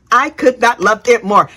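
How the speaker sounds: ordinary talk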